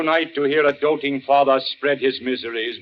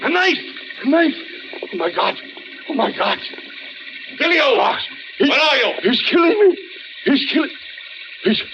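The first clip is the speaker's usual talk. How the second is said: panicked screaming; 11 semitones higher, faster than usual. Acted. Dinner guests scream in terror as the host is stabbed. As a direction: shriek in panic as the attack happens.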